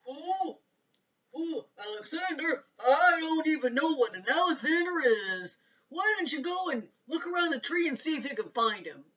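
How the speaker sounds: a wise owl who doesn't know. A narrator's reading. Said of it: "the owl said".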